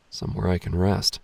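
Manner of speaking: usual speaking voice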